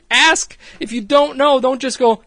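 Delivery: high, loud exasperated scolding; Kristen yelling. Retold or imitated. Boss scolding worker who didn't ask, exasperated yelling. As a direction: imitate a furious dressing-down at full pitch. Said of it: "Kristen rightfully yells at her".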